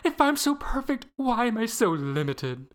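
imitating Loretta. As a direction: play the AI girl who resents being perfect. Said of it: "She goes".

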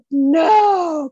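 loud high cry of "No"; anguished cry. Retold or imitated. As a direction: cry out "No" in disbelief. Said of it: "And you're like".

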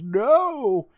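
high, loud scream. Retold or imitated. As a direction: yell it out in sudden terror. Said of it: "He screams".

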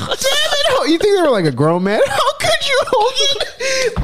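high laughing wail; laughing mock anguish. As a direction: cry out in laughing despair. Mock anguish at Hogan's betrayal.

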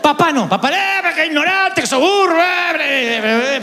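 high, mock-angry shouting; father yelling. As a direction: berate the dumb son, hammed up. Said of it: "o sea, gritaba un poquito".

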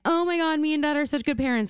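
high, gushing self-congratulation; imitating mom. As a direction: hurry it out in a high, pleased mom voice. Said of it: "she's gonna be like".

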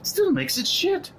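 very high, fed-up protest; exasperated rant. Acted. Roommate arguing over the apartment, exasperated. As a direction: push through the interruption, squeaky with exasperation.

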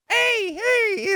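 very high cartoon mouse squeal; Mickey Mouse. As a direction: imitate a cartoon mascot's falsetto greeting. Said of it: "I'd be like Mickey Mouse".

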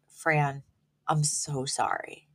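slow, softened imitation of a doctor; hushed, regretful retelling; grave bad news. Acting out doctor breaking bad news.

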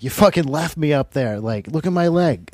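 throwing it in their faces; furious. Retold or imitated. To the group who abandoned him: angry shout. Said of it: "I was, like, furious".

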